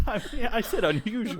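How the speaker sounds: giggling through the correction; laughing. Real, not acted. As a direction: laugh while you correct.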